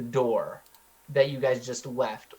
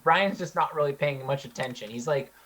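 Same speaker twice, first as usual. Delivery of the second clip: usual speaking voice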